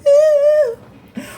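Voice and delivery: high excited shout; squealing hype yell; hyped intro yell. Real, not acted. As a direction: blast the greeting out, pumped.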